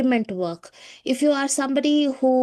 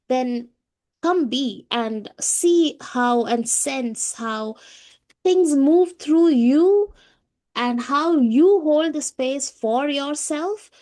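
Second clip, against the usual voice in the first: very slow, higher, stretched invitation; slow and drawn out; 4 semitones higher, much slower than usual. Real, not acted. Host inviting healers to come and feel it, slow and drawn out. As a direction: let each word hang, inviting them in.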